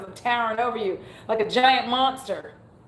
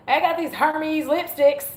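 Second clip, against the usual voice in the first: put-on Southern accent; 7 semitones higher than usual. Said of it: "if I said it Southern".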